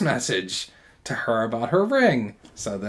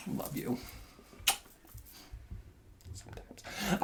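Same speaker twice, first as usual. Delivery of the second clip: teasing whisper; 19 dB quieter than usual. Real, not acted. Teasing partner.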